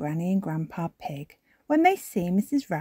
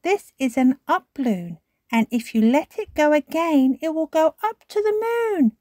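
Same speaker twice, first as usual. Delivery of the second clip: put-on high little-girl voice rising; Peppa explaining; 10 semitones higher, 5 dB louder than usual. A narrator's reading. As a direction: tell your brother a big secret. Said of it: "says Peppa".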